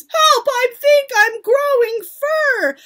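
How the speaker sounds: very high, loud acted shriek; panicked scream. A narrator's reading. Witch mimics boy growing fur, screaming. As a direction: scream for help in mock terror.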